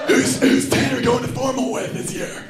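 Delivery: put-on high raspy sorority girl; lightly voiced party girl, husky; throaty voice. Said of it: "that deep, raspy voice".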